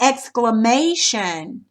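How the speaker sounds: exclamation with the third syllable MAY stressed. Shown as a demonstration. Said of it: "gonna go over the may part".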